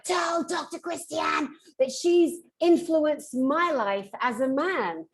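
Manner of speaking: high, slow, drawn-out proclamation; emphatic retold message. Relaying a friend's message to the doctor, emphatic and high.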